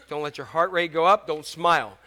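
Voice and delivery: ordinary talk, nothing marked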